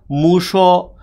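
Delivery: মুশো with an open o after sh; added vowel. Shown as a demonstration. Teacher removes the vowel-killer sign, saying মুশো with added o. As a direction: sound out মুশো as mu-sho, both vowels held. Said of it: "মুছে ফেলি তাহলে কি হবে".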